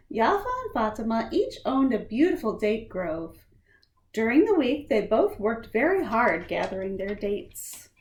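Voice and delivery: lightly lilting read-aloud voice; storybook reading. Acted. Reading a picture book to children, gently lilting. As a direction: tell it gently to young listeners.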